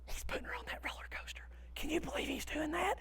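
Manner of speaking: hushed, disbelieving stage whisper; crowd whispering. Retold or imitated. Onlookers gossiping about the man and the girl, whispering. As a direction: whisper it quickly like scandalized onlookers. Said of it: "they're whispering all this".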